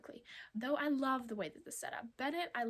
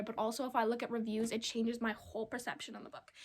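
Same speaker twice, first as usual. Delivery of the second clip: ordinary talk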